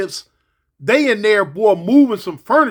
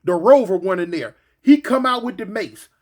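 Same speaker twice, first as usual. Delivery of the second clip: usual speaking voice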